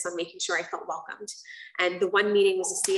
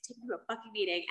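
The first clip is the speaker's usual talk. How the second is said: mock angry demand, softer; 3 semitones higher, 8 dB quieter than usual. Teen to her mom, softer angry grumble. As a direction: grumble it angrily under the breath. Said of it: "I'm angry and I'm mad".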